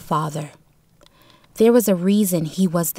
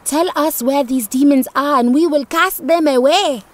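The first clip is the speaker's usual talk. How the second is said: higher, louder ringing proclamation; proclaiming; 6 semitones higher, 4 dB louder than usual. A narrator's reading. Shaman answers the spirit before the village, proclaiming loudly. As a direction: call it out to the spirit with authority. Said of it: "the shaman proclaimed in his own voice again".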